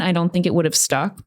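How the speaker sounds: ordinary talk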